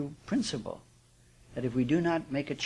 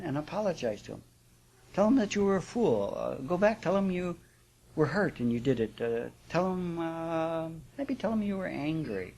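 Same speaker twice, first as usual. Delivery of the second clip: higher-pitched woman offering suggestions; advising friend; 5 semitones higher than usual. Acted. One woman urging another to apologize to her husband, advising friend. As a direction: voice the other woman, pitched well up. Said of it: "And the other woman says".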